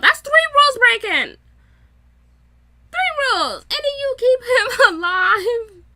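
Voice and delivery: outraged outburst. Real, not acted. Vlogger ranting to viewers about Louis breaking rules, outraged shout. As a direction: explode at the broken rules, half laughing.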